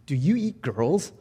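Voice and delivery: put-on slow, stammering frightened girl; terrified. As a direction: ask it with a scared catch. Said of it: "she asked fearfully".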